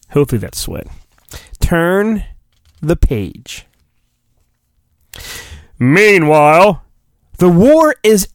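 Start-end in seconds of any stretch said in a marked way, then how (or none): none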